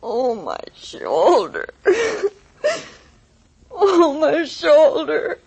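slow, drawn-out moan of pain. Acted. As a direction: groan through an aching shoulder. Injured woman comes to, in pain.

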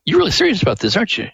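a lightly voiced print shop woman, taken aback; amazed shop owner. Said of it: "and he said, "Well, Lester".

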